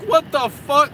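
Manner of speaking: high, loud exclamation of disbelief; retold shock. Student reacting to his terrible test score, retold shock. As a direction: replay your shock at full volume.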